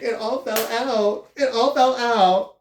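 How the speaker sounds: high, slow, wailing sob-voice; dramatic high wail, drawn out; mock crying. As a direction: ham up the girl sobbing over lost hair. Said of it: "crying in the washroom".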